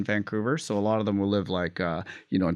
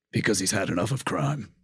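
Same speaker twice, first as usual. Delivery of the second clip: Batman voice. Shown as a demonstration. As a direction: croak it out like a crime-weary vigilante. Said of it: "The bat voice is, like, just really croaky".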